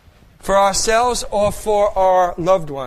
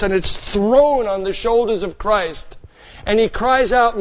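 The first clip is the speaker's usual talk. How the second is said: ordinary talk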